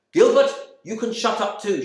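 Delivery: high, loud telling-off; scolding. Retold or imitated. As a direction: cut him short, exasperated. Wife silences the lodger.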